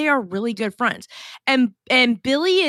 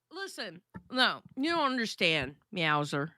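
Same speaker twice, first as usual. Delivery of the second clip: ordinary delivery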